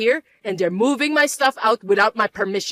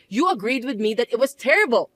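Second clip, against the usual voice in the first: ordinary delivery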